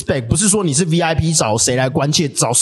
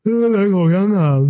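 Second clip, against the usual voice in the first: imitating slurred speech; 2 semitones higher, slower than usual. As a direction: drag each word out, mushy and loose. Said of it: "对，对，他讲话，". (慢慢地模仿口齿不清的说话)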